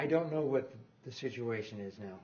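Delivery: usual speaking voice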